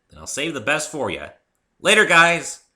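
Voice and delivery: high, loud shout of farewell; calling goodbye. A narrator's reading. Rainbow Dash shouts goodbye while taking off, loud. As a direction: call it over your shoulder as you fly.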